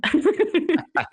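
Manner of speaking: a quick breathy chuckle. Real, not acted. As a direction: laugh it off lightly. Guest laughs at own remark.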